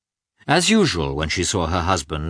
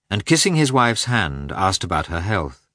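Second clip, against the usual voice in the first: usual speaking voice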